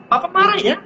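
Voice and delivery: put-on high childlike questioning voice; little child voice. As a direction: play a tiny child checking papa's mood. Child reading father's face.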